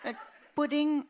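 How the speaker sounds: ordinary delivery, nothing marked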